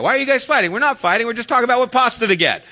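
high, loud, rapid-fire protest; hammed-up Italian argument over nothing; mock Italian yelling. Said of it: "Italian is like that".

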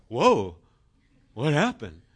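loud, startled whoa; hammed-up bewilderment; surprised dog. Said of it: "they're like".

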